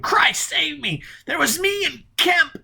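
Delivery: very high, slow, pleading cry; desperate scream. Acted. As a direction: beg for life as you scream. Drowning man confessing in terror.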